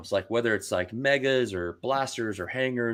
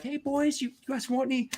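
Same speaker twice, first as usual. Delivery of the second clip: much higher, sweet Southern-mom voice; sing-song hostess offering food; imitating friendly mom. Telling how Scott's mom offered them dinner, in a high sweet mock Southern voice. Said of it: "She's like".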